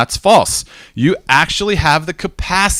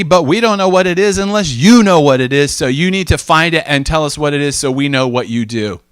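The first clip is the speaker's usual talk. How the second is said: retold coworker; 6 semitones higher, 4 dB louder than usual. Coworker's long quote, higher pitch.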